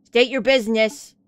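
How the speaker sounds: loud, official challenge; gruff official gatekeeper. Acted. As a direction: demand it like a guard at the gate. Game guard repeats his demand.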